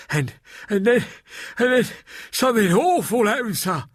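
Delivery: a shaken maid pleading her tale; frightened maid Delilah. Acted. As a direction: Delilah telling Mister Box what happened, frightened. Delilah tells her story.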